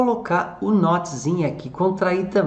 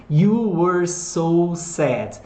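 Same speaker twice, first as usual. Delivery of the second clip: very slow; much slower than usual. Shown as a demonstration. Teacher models English sentence.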